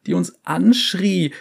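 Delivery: high, frightened survivor voice; traumatized scientist. Acted. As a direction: recount the horror, shaken. Second survivor's shaky testimony.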